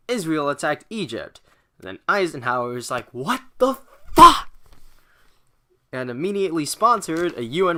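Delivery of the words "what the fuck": loud, high mock outrage; outraged shout. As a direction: yell it in disbelief. Eisenhower outraged at the invasion.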